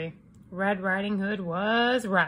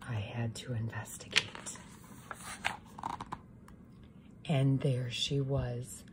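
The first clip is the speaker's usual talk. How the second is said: low, soft, conspiratorial; sneaky whisper; 8 semitones lower, 10 dB quieter than usual. A narrator's reading. Wolf sneaking up on Red, hushed. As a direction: creep in quietly.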